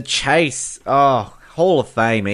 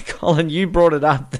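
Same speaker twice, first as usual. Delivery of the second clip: ordinary delivery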